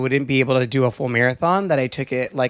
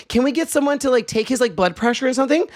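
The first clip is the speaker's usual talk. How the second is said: raised, urgent imitation of a helper; retold plea for assistance, pitched up; calling for help; 8 semitones higher than usual. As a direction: call out higher, asking around the room.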